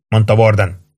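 plain reading voice

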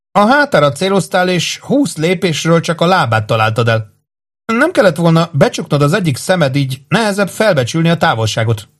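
put-on high-pitched, lecturing girl's voice; scornful girl schooling a poor archer, pitched well up; critical young woman. A narrator's reading.

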